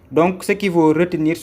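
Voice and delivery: ordinary talk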